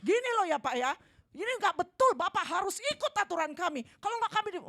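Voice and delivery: loud, high, forceful demand; harsh demanding tone. Acted. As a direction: lay down the rules aggressively. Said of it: "Intonasi suara itu juga strategi". (menuntut dengan keras dan tinggi)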